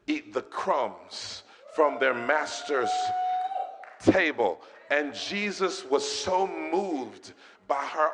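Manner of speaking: loud, high, impassioned preacher; full-force preaching. Real, not acted. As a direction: build to a shouted sermon climax.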